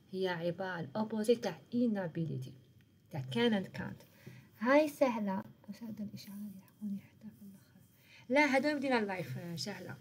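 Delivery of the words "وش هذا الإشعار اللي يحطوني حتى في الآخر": hushed, fast whispered aside. Real, not acted. Teacher puzzled by a notification, whispering. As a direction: whisper it quickly to yourself, off to the side.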